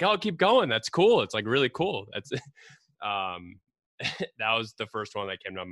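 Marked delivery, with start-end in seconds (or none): 0.0-2.1 high, loud egging on; cheering. Retold or imitated. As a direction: cheer him on, thrilled. Kids cheering climber on.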